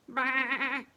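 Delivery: loud bleat; loud sheep baa. Acted. Sheep voiced.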